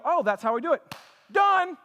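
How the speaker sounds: high, loud, sudden "Done"; mock triumph. Mocking self-help readers, bursting out high. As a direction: act out a reader solved by one book. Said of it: "read it, and go".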